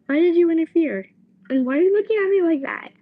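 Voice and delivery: high, loud accusing whine; whiny Zenitsu. Retold or imitated. Boy whines at his friend for interfering, high and loud. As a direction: blame your friend, loudly whining. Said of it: "he's talking to Tanjiro".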